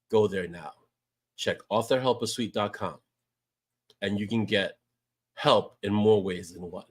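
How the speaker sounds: deep smooth voice selling the product; commercial voice. Shown as a demonstration. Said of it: "Yes, that was my commercial voice".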